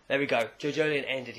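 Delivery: ordinary talk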